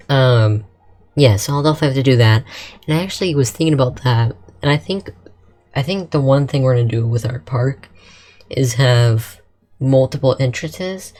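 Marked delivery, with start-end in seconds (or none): none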